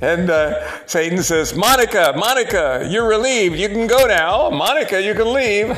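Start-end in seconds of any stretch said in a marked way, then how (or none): 1.5-5.8 high, loud singsong summons; calling out. Acted. Satan relieving Monica of her post, high loud calling. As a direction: call out a gleeful dismissal.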